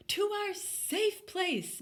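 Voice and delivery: high, slow screech. A narrator's reading. Mom screeching to flee to their safe place, high. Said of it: "Mom screeched".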